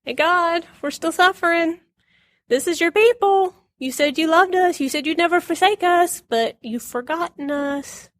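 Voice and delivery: high, pleading appeal; pleading prophet. Retold or imitated. Jeremiah pleading with God for his suffering people, high. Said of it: "Guess who he's talking to?".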